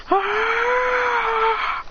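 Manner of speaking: long high strained scream; power-up scream. Acted. Adrian unleashing the fire tornado, screaming. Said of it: "He goes".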